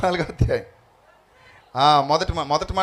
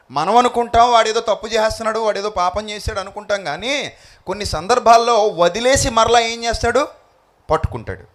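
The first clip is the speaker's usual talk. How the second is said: higher, louder questioning to the crowd; rhetorical preaching; 5 semitones higher, 4 dB louder than usual. Acted. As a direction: challenge them with each question.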